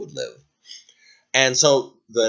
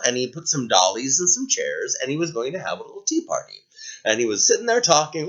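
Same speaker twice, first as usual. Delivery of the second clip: ordinary delivery, nothing marked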